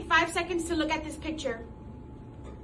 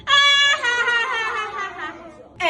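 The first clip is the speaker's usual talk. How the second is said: high, loud ha ha ha leading the class; laugh along; 9 semitones higher, 8 dB louder than usual. Shown as a demonstration. Teacher leading children in a laugh, high and loud. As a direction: laugh out loud for the kids to join. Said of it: "Laugh with me".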